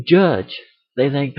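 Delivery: ordinary talk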